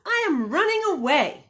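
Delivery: high, loud little bunny. Acted. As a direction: play the runaway bunny, bright and eager. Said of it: "So he said to his mother".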